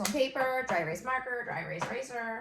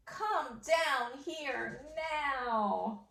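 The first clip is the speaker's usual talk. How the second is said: slow dictation; 5 semitones higher, much slower than usual. Shown as a demonstration. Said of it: "dictating the sentence".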